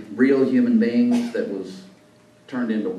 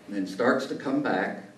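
ordinary delivery

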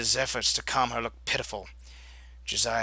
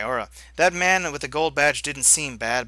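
normal talking voice

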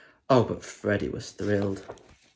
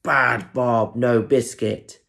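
louder stern scolding; angry dad; 5 dB louder, slower than usual. Acted. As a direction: tell the dog off.

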